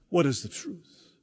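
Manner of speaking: ordinary talk, nothing marked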